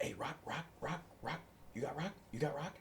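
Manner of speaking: quietly solicit like a twitchy street buyer; furtive crackhead. Retold or imitated. Crackhead furtively asking to buy.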